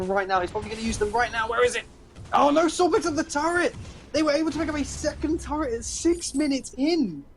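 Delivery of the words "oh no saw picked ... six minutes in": loud, high-pitched shocked commentary; excited turret call. Real, not acted. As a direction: shout the shock of a live game upset. Commentator reacts to turret falling.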